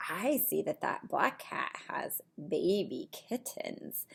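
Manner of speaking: ordinary delivery, nothing marked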